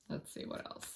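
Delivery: voiceless murmur to herself; whispered aside. Real, not acted. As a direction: breathe it under your breath while shuffling. Muttering aside while drawing cards.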